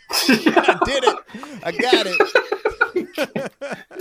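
high, slow, helpless laughter; laughing. Real, not acted. Game master pleased at the voice, laughing hard. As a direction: get the words out through real laughter.

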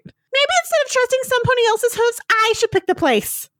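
high, bouncy, desperate pony voice; Pinkie Pie. Acted. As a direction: chirp it out like a desperate cartoon pony. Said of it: "Pinkie Pie is desperate at this point".

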